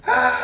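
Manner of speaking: high, loud scream of grief; anguished scream. A narrator's reading. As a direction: let out a raw, piercing cry of despair. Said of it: "and screams".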